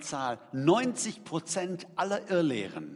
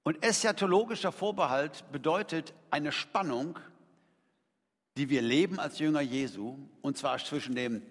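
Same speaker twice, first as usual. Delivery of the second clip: ordinary talk, nothing marked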